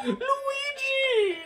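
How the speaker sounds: high, drawn-out crying call; wailing Mario. Retold or imitated. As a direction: wail the name in hammed-up despair. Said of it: "He was just like".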